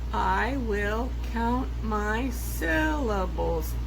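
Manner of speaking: each syllable spelled out slowly; slow syllable count. Shown as a demonstration. Teacher slowly counting syllables to class. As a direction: count every beat slowly.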